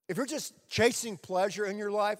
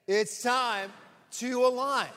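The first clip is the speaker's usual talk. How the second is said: rousing call-and-response, pushed up; louder, higher repeat; 5 semitones higher, 3 dB louder, slower than usual. Shown as a demonstration. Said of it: "Let's say it again".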